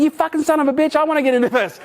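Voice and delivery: playfully jealous outburst; excited envy. Retold or imitated. Telling his lucky mate off, excited. Retold envious reaction to mate.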